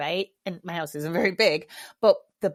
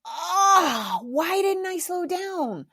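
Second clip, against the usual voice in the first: regretful wail; 9 semitones higher, 4 dB louder, much slower than usual. Retold or imitated. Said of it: "you're gonna be like".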